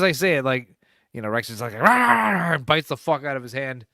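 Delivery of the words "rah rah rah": a loud, higher mock dog bark-growl; dog snarl imitation.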